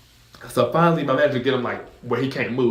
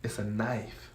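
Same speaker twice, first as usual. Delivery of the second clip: deadpan aside; 10 dB quieter, slower than usual. Retold or imitated. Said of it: "I'm just like".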